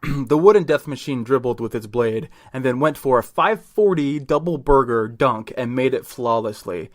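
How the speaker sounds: usual speaking voice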